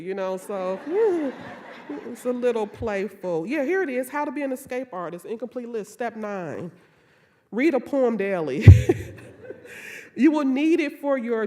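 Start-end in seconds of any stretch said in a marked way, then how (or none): 0.8-1.8 quick bright laugh; short amused laugh; light laugh. Real, not acted. Laugh mid talk. 8.7-10.1 airy unvoiced laugh; amused breathy chuckle. Real, not acted. Laugh after reading line.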